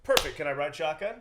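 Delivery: high, loud, eager request; Jeff Bezos. Retold or imitated. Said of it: "And he goes".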